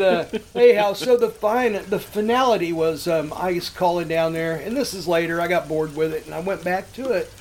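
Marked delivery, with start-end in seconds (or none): none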